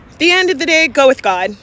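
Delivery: ordinary delivery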